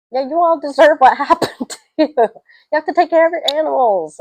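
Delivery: high, loud telling-off with a laugh in it; indignant scolding. Real, not acted. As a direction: scold them for neglect, pitched right up. Scolds film family for neglect.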